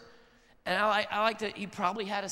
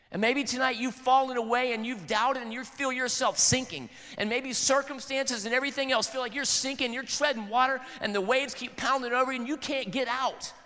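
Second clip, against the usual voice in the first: full-force preaching; 5 semitones higher than usual. Acted. Pastor urging the sinking listeners, full-force preaching. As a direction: drive it home to the congregation.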